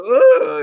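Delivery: very high, loud imitated scream; mock shocked cry. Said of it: "he's all shocked".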